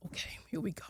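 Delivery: whispered under her breath; whispered to herself. Retold or imitated. Seeing her daughter cry, she whispers to herself. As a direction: breathe it out as you brace yourself. Bracing herself as tears start.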